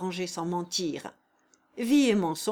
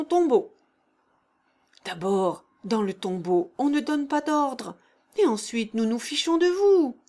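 defiant scanned taunt, raised voice; rhythmic taunt; 4 semitones higher, 4 dB louder than usual. A narrator's reading. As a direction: chant it loud, mocking and rising. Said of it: "scanda Klinevitch".

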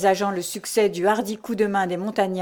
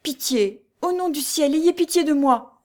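high-pitched begging, building louder; frightened plea; 7 semitones higher, 3 dB louder than usual. A narrator's reading. Overwhelmed cantinière begs the monk, frightened plea. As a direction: beg for mercy in rising panic.

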